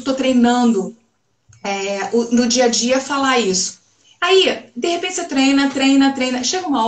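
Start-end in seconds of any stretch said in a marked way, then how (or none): none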